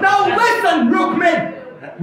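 angry call. Acted. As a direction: call him to order. Balaram losing patience.

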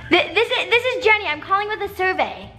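ordinary talk, nothing marked

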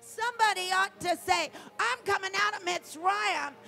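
loud, rising preacher's cry, shouting the last line; full-throated revival preaching; preaching at full force. Acted. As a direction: preach it out with everything. Preaching climax on redemption.

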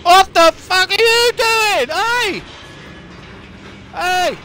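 high, loud, drawn-out shout of shock; startled yell. Real, not acted. Player caught off guard by an inmate, startled yell. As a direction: yell out in real alarm.

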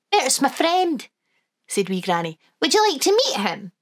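put-on high, lilting old Scottish granny; Wee Granny voice. Granny to the children, high and fond.

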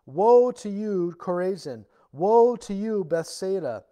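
loud, slow proclaiming of woe; proclaimed woes. Acted. Preacher booming Jesus' woes at the towns. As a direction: cry out the woes like a prophet.

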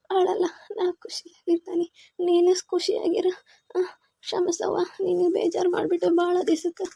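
whispered through real tears; tearful, barely voiced whisper; crying whisper. Real, not acted. Mother reassuring her son, whispering through tears. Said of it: "ಅಳ್ಬಾರ್ದು".

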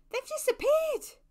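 high, loud cry of amazement; astonished exclamation. A narrator's reading. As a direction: exclaim it in sheer surprise. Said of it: "she exclaimed".

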